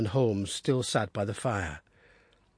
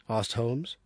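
ordinary delivery, nothing marked

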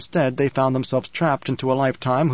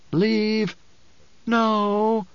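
strained inner voice; 8 semitones higher than usual. Acted. Possessed voice argues within.

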